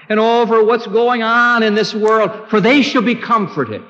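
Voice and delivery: high, ringing pulpit proclamation; fervent scripture proclamation; impassioned preaching. Real, not acted. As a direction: lift it high over the congregation. Preaches with full force.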